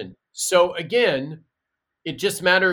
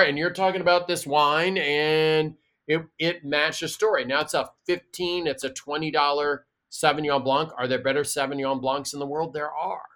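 ordinary talk, nothing marked

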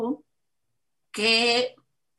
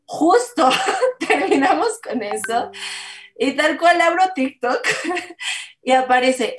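laughing; 4 semitones higher, 5 dB louder than usual. Real, not acted. Laughs recalling coincidence.

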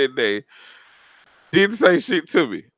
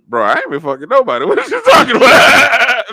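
laughing quote; 7 semitones higher, 7 dB louder than usual. Retold or imitated.

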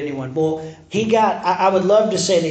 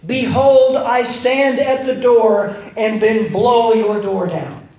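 loud, higher, drawn-out proclamation; mock booming command; 3 semitones higher, 5 dB louder, slower than usual. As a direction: blast it out like a forceful entrance. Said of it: "Jesus does not say in Revelation".

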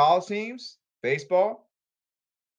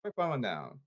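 ordinary talk

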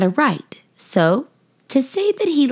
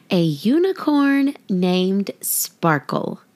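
plain reading voice

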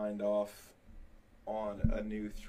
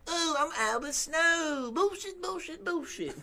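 put-on high voice; 4 dB louder than usual. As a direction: squeal it in a silly falsetto. Mock figure introducing himself.

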